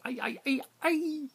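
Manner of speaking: high, loud anguished cries; pained scream. Retold or imitated. Crewman protesting the dumped rubbish, pained high scream. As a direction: wail it out as if it hurts. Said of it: "One of them almost screamed, a pained sort of".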